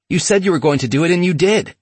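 excited scream. Retold or imitated. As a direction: rush it out, overjoyed. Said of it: "he screamed".

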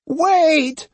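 very high, drawn-out screech; shriek. A narrator's reading. Old Osugi shrieking at fleeing Otsu to stop. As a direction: shriek it after the runaway. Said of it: "she shrieked again".

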